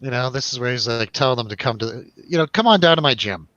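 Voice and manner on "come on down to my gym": normal talking voice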